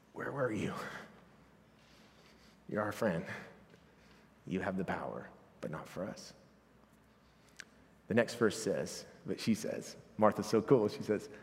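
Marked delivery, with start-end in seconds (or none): 0.1-6.3 grieving reproach. Acted. Martha's grieving reproach voiced softly.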